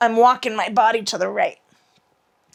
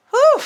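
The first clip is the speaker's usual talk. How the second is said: exertion whew; 8 dB louder than usual. Real, not acted. Releasing effort after twist.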